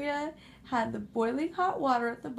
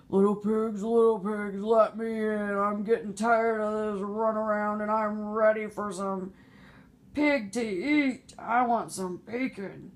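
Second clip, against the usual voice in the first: louder, menacing wolf call; big bad wolf; 4 dB louder than usual. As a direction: demand entry like a hungry wolf. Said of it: "Big Bad Wolf said".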